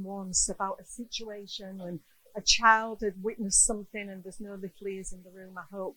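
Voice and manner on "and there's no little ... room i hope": hushed aside. Real, not acted. Aside mid story.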